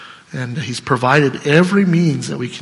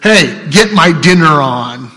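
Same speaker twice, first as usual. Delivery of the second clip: gruff master's order; 6 dB louder than usual. Retold or imitated. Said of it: "what the master says is".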